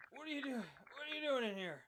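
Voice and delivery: high, dazed waking question; groggy man. Acted. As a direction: come to confused and groggy. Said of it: "He's groggy".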